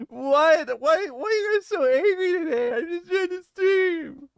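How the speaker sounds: high, put-on whiny streamer voice; mocking whiny streamer. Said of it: "I hate when streamers do that".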